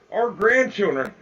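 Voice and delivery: usual speaking voice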